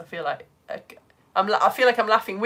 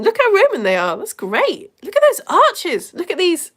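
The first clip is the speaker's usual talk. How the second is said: gushing; 9 semitones higher, 8 dB louder than usual. Real, not acted. Gushing over a viewer's drawing.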